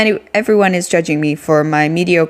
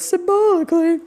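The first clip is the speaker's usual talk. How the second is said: high squeaky cracking voice; 12 semitones higher than usual. Shown as a demonstration. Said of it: "That was a voice crack".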